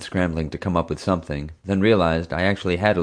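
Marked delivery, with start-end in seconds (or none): none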